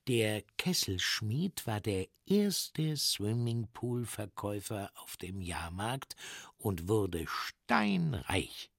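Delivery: plain reading voice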